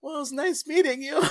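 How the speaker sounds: high, sheepish retold sign-off; mock-cheery, embarrassed farewell; awkward goodbye. Awkward retreat after the insult.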